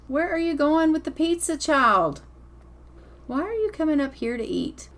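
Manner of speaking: high, mock-stern call-out to a kid; a fussy parent catching a kid sneaking food; scolding parent. Gamer scolding Tegan for carrying pizza off, mock parent voice.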